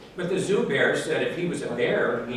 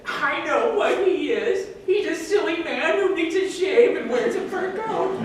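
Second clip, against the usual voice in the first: much higher, small giggling cub; baby bear voice; 4 dB louder than usual. Acted. Baby zoo bear teasing the bear, high and giggling. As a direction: squeak it like a tiny bear cub. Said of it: "One little baby circus bear said".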